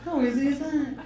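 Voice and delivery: lightly voiced sing-song TV chef catchphrase; mild mock celebrity chef, catchphrase delivery; imitating a TV chef's catchphrase. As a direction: toss it off like a cheerful cooking-show host. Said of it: "That's her trademark phrase".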